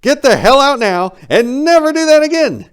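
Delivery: very high, loud furious yell; ordering out. Acted. Narrator yelling the owl out of the bathroom. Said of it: "ordered the damn owl out".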